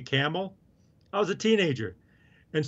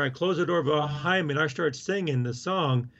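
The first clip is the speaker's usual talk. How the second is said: usual speaking voice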